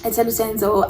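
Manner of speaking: plain reading voice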